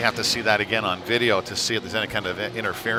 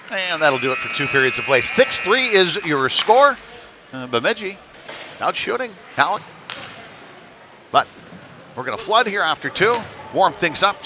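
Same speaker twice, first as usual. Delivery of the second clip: lifted, higher-pitched broadcast voice; announcer sign-off; 7 semitones higher, 3 dB louder than usual. Real, not acted. Announcer sends listeners to break after two periods, lifted sign-off.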